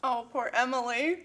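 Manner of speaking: ordinary talk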